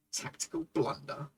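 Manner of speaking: hushed, rueful whisper. Real, not acted. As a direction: breathe it out like a private grumble. Repeats headline under his breath.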